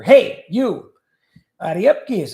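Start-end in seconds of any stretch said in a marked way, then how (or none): 0.0-0.9 called out loud and sharp, a step higher; loud hey you. Shown as a demonstration. Gloss of the Hindi word, called out loudly. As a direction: shout it across the room to get someone's attention. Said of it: "Ariapki is like the Hindi word for".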